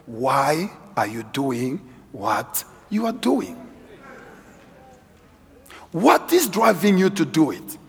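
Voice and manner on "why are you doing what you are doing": slow, softer, probing question; slow searching question. Shown as a demonstration. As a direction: ask it slowly and quietly, as if to yourself. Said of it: "Have you ever asked yourself".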